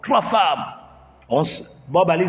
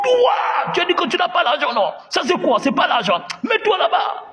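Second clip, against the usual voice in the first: furious shouting; 12 semitones higher than usual. Acted. The big brother yelling at the migrant caught hiding money. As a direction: scream at him for lying.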